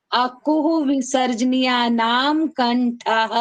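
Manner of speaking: very slow, drawn-out sutra chant; slow recitation. Shown as a demonstration. Teacher chants the sutra slowly for students to repeat. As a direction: stretch every syllable for the class. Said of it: "आप बोलिए".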